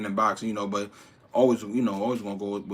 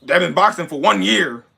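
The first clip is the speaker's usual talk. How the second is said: mock bragging; 7 semitones higher, 8 dB louder than usual. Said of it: "You doing the same thing".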